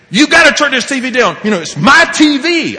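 high, loud shouted demand; angry father. Retold or imitated. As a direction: re-enact yelling to take back control.

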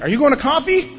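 high, bright mock-eager ask; eager question. Newcomer asking the wild kid about coffee, mock eager and high. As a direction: jump at the chance, playing it up. Answering the kid who asks for sponsorship.

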